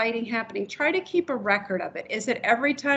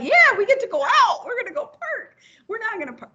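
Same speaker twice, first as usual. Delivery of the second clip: high, bright-eyed puppy chatter, much higher; excited puppy voice; 11 semitones higher, 3 dB louder, faster than usual. Retold or imitated. Trainer voicing the puppy's excited thoughts, high puppy voice. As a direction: play the thrilled dog who thinks it's park time.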